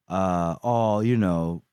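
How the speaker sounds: ordinary talk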